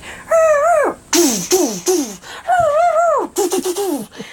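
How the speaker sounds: high mouth-made gunshot noises; imitated gunfire. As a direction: mimic bursts of gunfire with the voice. Mimics gunfire heard at night.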